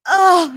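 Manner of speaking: high, loud mock swoon; melodramatic cry. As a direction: cry out as if flinging yourself at a wall. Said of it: "I just throw myself against the wall".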